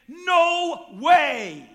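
a firm refusal, shouted; emphatic shout. Acted. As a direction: shout it out, no doubt about it. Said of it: "or to put it today".

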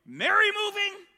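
high, loud mock amazement; mock astonished crowd. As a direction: gasp it out like shocked townsfolk. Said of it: "people are like".

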